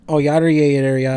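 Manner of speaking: low, loud Wisconsin "oh yeah"; Wisconsin accent. Acted. Friend offers co-host Wisconsin talk, low hammed-up accent. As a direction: rattle off yeahs in a Wisconsin accent. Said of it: "like, Wisconsin".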